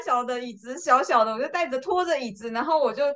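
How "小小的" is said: ordinary talk, nothing marked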